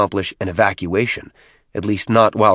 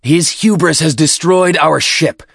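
much higher, louder and slow; loud accusation; 8 semitones higher, 6 dB louder than usual. Acted. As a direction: denounce him before the pack. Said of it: "Tartarus continued".

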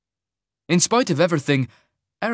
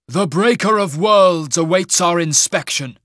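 higher, louder, slow proclamation; general's announcement; 5 semitones higher, 6 dB louder than usual. A narrator's reading. General announces an inspection, grand and commanding. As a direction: announce it grandly, drawing out each word. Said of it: "the general announced".

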